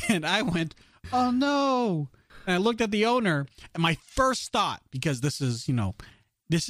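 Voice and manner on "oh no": mock dismay. Retold own reaction.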